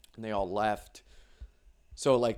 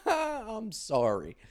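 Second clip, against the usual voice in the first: mock apology; 10 semitones higher, much slower than usual.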